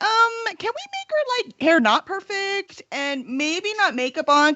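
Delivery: high, tentative, hammed-up asking voice; mock timid author request. Author politely asking the cover artist for changes, mock timid. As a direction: put on a sweet, hesitant plea like the fussy author. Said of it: "you and Megan were like".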